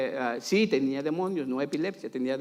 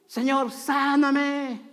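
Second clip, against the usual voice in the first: high, long-drawn mock cry to God; desperate plea; 9 semitones higher, much slower than usual. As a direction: stretch it into a pleading wail. Acted desperate prayer for healing.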